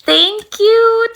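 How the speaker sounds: high, loud, gushing thank-you; delighted shout of thanks; excited thanks. Real, not acted. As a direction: sing out your thanks to a viewer. Thanking a viewer on livestream.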